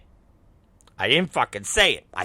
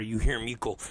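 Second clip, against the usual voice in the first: ordinary delivery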